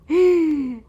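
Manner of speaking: high loud gasp of shock; sharp surprised gasp. Real, not acted. Man startled by cat kissing, gasping.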